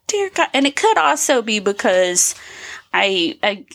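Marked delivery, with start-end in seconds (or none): 0.1-0.5 high, exasperated exclamation. Retold or imitated. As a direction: gasp it out in disbelief. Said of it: "I was like".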